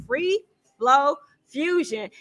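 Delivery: "free flow fusion" drawn out word by word; slow right down on "free flow fusion"; deliberately slow. Real, not acted. Host avoids tripping on the event name, saying it slowly. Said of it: "That's why I keep saying it slow".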